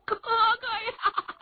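slow, very high sobbing wail; wailing cry. Acted. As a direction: drag it out in a fake sob. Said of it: "哭着哭着".

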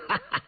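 high, light ha-ha; quick confident burst of laughter; hearty laugh. A narrator's reading. Prince rising to take on the task, laughing. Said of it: "胤翔听着已经站起身来".

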